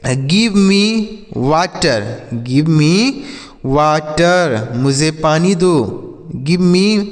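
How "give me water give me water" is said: very slow. Shown as a demonstration. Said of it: "जैसे".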